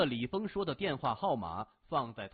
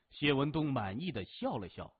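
ordinary delivery

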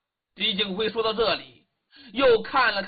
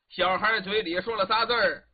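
ordinary delivery

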